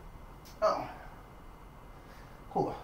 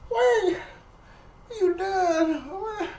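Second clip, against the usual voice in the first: much higher astonished wait, you done; disbelief; slower than usual. Retold or imitated. Patient stunned the nurse already removed the stitches, very high. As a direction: squeal in amazement it's over already. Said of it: "I said".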